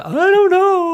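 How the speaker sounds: high, loud crying wail; panicked wail. Retold or imitated. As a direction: wail it out helplessly. Retold panicked answer to husband.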